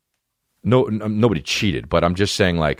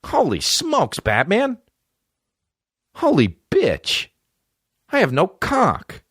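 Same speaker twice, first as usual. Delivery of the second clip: much higher, slower mock sidekick squeak; hammed-up high Robin voice; slower than usual. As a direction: lampoon the eager boy wonder. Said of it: "Dick Grayson?".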